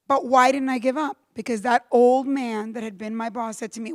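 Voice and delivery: normal talking voice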